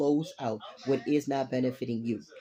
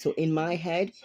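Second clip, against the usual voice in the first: ordinary talk, nothing marked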